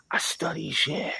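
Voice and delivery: breathy whispered aside. Real, not acted. Confiding to listeners that he studies everything, whispered. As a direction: drop to a whisper.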